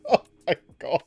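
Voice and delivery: normal talking voice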